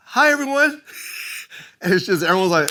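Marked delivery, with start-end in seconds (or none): 0.1-0.8 high-pitched little hello; mock stage greeting. Comic greeting the crowd through the curtain, high and small. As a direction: pipe it up high, out of the usual voice. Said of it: "and I'm like".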